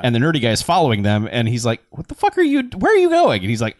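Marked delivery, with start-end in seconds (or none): 1.9-3.4 high, loud, rising indignant shout; much higher and louder, outraged; startled yell. Retold or imitated. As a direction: call after them, caught off guard. Retold nerdy guy confronting friends.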